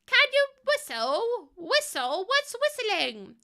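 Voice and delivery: high, slow child cartoon sheep; Susie Sheep voice. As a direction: squeak it like a little cartoon sheep. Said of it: "like Susie Sheep".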